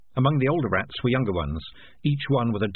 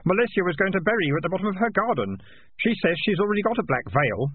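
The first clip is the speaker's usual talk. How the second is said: put-on young boy voice; 9 semitones higher than usual. A narrator's reading. Keith speaks.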